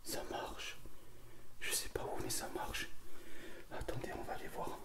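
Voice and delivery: hushed ghost-hunt whisper. Real, not acted. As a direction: whisper so nothing hears you. Whispering in the castle.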